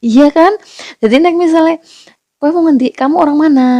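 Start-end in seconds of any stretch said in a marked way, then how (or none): 2.4-3.7 acted question. Acting the asker again.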